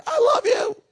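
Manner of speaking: shrill-free high squealed I love you, hammed-up; gushing shout. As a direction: burst out with it like the clerk. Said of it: "be like".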